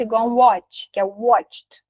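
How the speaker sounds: usual speaking voice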